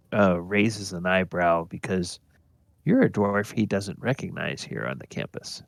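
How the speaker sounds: normal talking voice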